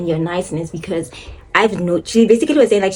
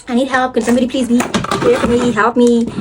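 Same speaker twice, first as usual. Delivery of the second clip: higher-pitched mock cry for help; mock yell for help; 4 semitones higher than usual. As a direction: call out for help, half in jest. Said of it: "when I do yell for help".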